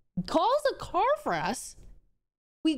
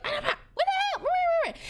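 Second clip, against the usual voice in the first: much higher, frantic mock alarm; mock panic; 13 semitones higher, much faster than usual. People at the crash scene panicking, much higher mock alarm. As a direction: squeal it like a startled bystander.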